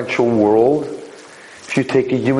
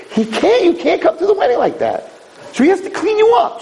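very high, forceful preaching; impassioned preaching. Real, not acted. Rabbi explaining why God must clean you, impassioned high preaching. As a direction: cry out the point with urgency.

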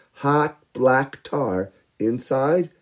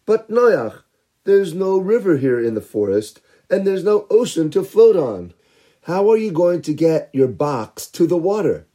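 scoffing villagers; 6 semitones higher, 3 dB louder than usual. Acted. People challenge Noach.

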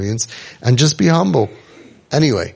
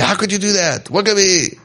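high, horrified disbelief; shocked outburst; 8 semitones higher than usual. Retold or imitated. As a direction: recoil at what you hear, pitched up. Parent reacting with shock.

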